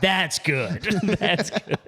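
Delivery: chuckling approval; laughing. Real, not acted. Reacting to the roommate's joke, laughing. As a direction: laugh through the praise.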